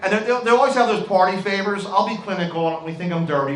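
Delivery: ordinary talk, nothing marked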